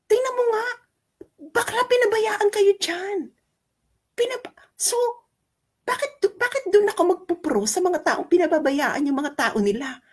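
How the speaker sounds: very high-pitched, exasperated rant; indignant disbelief. Real, not acted. Indignant rant at critics' logic. (hindi makapaniwala, mataas ang boses na pagrereklamo)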